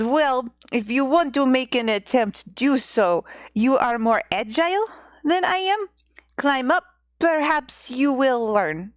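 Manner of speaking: put-on old husband urging, Russian accent. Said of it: "my terrible Russian accent".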